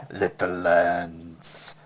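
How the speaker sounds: slow, drawn-out "Little" call. Acted. Calling again for Lance, slowly drawn out. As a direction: stretch each word as you call.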